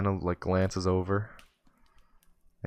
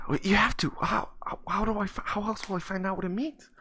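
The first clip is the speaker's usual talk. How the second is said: high-pitched, stammering plea; pleading kid; 12 semitones higher than usual. Acted. Kid begs homeless man for the meaning, pleading. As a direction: beg in a high, flustered voice.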